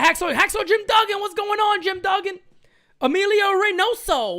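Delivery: high-pitched hype greeting to the chat; excited shoutouts. Real, not acted. Streamer hypes up a viewer by name, excited shout. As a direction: call out the names with big energy.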